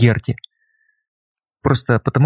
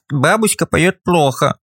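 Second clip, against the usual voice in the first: put-on sniffly child voice; 5 semitones higher, slower than usual. A narrator's reading. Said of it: "детский шмыгающий носом голосок".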